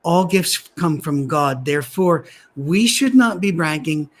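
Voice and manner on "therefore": ordinary delivery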